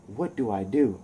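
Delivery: hushed inner monologue of a hero; soft, pondering to himself; thinking aloud. Acted. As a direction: murmur it like a private thought. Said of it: "and he's thinking".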